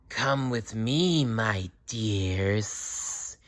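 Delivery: slow, sly snake voice; hissing snake. A narrator's reading. Adder luring the mice and voles, a slow sly hiss. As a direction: tempt the little ones, slow and sly. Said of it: "she hissed".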